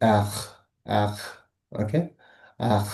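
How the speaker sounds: এখ ending in a throaty kh; Portuguese R. Shown as a demonstration. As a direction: repeat এখ with a back-of-throat fricative. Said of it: "আরটাকে ওরা বলে হচ্ছে".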